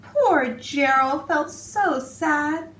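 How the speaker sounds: put-on expressive read-aloud for young children; storybook narration. A narrator's reading. Teacher reading a picture book to her class, expressive storytelling. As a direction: tell it like bedtime story time, rhythmic and lively.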